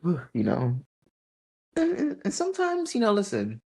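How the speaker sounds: high, tearful false starts; choked up. Real, not acted. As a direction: struggle to speak through real tears. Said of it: "why I'm crying?".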